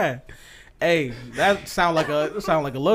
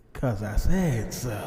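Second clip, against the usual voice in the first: slow drawn catchphrase; 4 semitones lower, much slower than usual. Acted.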